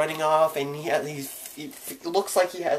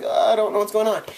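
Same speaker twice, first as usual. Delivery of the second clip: higher, louder, fast panicked cry; 7 semitones higher, 6 dB louder, faster than usual. Retold or imitated. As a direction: blurt it out in a rush of panic. Panicking during family chaos.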